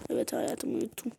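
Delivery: whispered so as not to wake her. Pretend. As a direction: breathe the answer softly. Husband whispering child is asleep. (uyuyan çocuğu uyandırmamak için fısıldayan baba)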